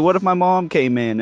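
high, alarmed question; mock panic, pitched well up; panicked friend. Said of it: "he's like".

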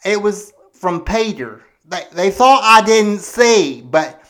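normal talking voice

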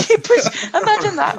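laughing through the words. Real, not acted. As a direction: say it while cracking up. Laughing at combining idea.

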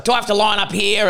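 put-on high, snippy old lady voice; mock an impatient old woman; cranky old lady. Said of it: "she was angry at them already".

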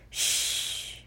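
put-on breathy hush to quiet the girls; whispered shh; soft shush. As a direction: shush them so Daddy sleeps. Mother hushes noisy girls.

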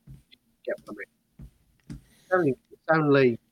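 mic cutting in and out; tape dropouts. Real, not acted. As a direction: sound dropping out mid-sentence. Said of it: "your sound keeps cutting out".

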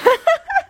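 high burst of laughter; loud laugh. Real, not acted. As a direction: crack up out loud. Laughing at the prospector bit.

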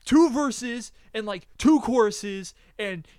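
high, drawn-out retold argument; mock exasperated objection. Friend arguing the song is bad, mock exasperated objection.

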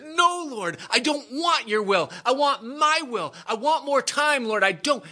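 hammed-up high wail; acted weepy begging; tearful pleading. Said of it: "he wept like a bird".

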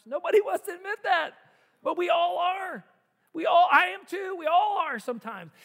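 high, loud, forceful preaching; high, loud emphatic shout. Real, not acted. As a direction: drive it home to the congregation. Preacher driving point home.

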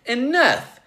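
very high, loud outburst; exasperated shout. Acted. Joe throws the book down, exasperated shout. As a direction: cut it off with a yell.

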